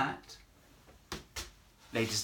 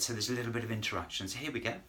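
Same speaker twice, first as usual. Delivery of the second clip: ordinary talk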